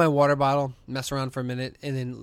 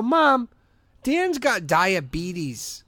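exasperated; 8 semitones higher, 4 dB louder, slower than usual. Retold or imitated. Arguing with mom.

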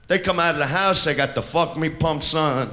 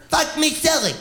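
mock scream; 10 semitones higher than usual. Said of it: "she's screaming".

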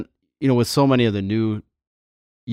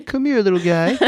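put-on high sing-song baby talk; 11 semitones higher, 5 dB louder than usual. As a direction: coo it like talking to a baby. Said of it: "talk to them in a baby voice".

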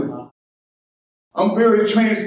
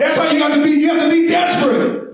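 high, shouted pulpit exhortation; impassioned, raised preacher voice; full-force preaching; 8 semitones higher, 4 dB louder than usual. Acted. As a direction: shout it out to the congregation.

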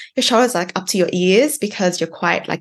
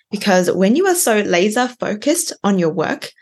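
normal talking voice

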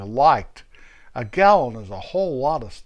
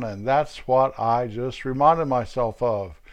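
ordinary talk

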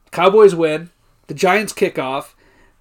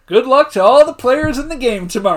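cop on loudspeaker; 6 semitones higher, 4 dB louder than usual. Retold or imitated. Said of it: "He's got, like, the fucking P A system".

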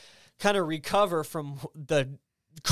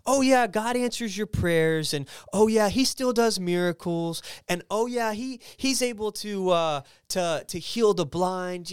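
mocking church talk; 5 semitones higher, 3 dB louder than usual.

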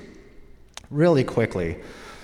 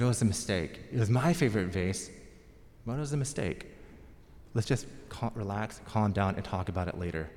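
calming mom; 3 semitones lower, 7 dB quieter than usual. Retold or imitated. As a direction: hush an angry husband gently. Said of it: "calmed my dad down".

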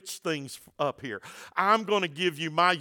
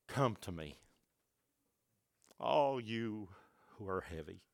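much softer and lower, gentle; tender invitation; 8 semitones lower, 13 dB quieter than usual. Shown as a demonstration. Jesus gently calling the weary, soft and tender. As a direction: breathe it out tenderly. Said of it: "What about Jesus came to them and did this?".

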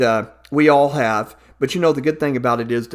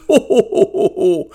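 jolly ho ho ho; 3 semitones higher than usual. Acted. Santa laughing.

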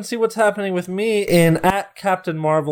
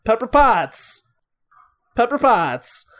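Pete impression; 4 semitones higher, slower than usual. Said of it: "I'll do a Pete impression".

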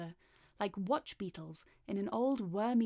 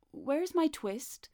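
higher, slow, measured question; questioning the cat; 6 semitones higher, slower than usual. A narrator's reading. Tailor asking Simpkin for his twist, slow questioning. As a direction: ask slowly and pointedly, without shouting.

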